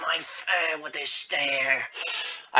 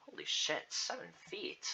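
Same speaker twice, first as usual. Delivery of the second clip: very soft, near-whispered disbelief; hushed amazement; 13 dB quieter than usual. Real, not acted. Reader reacting to Agnes's height, hushed. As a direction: say it under your breath, amazed.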